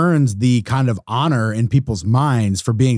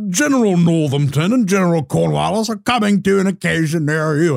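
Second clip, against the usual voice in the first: mock town crier; 5 semitones higher than usual.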